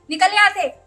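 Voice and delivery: loud, angry order to get out; furious wife shouting her out. Acted. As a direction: throw her out of the house. Wife throws out intruder again.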